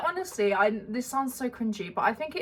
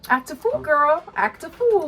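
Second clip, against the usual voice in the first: high, loud sassy chant; 6 semitones higher, 4 dB louder than usual. Pretend. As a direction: repeat it with rising sass. Repeating the sassy phrase.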